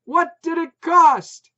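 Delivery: high, loud, slow yell; shouting. A narrator's reading. Putz demanding the price over barking, loud slow. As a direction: spell it out loudly.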